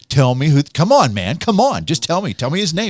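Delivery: loud, high, insistent pestering; pushy retold coaxing. Howard Stern pressing his guest for a name, pushy imitation. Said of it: "Just push, push, push".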